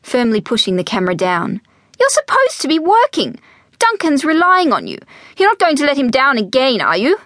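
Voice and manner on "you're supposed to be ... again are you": high, nagging reproach; scolding mother. Acted. Mother reminds daughter of her job, scolding. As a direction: press her hard, pitch up.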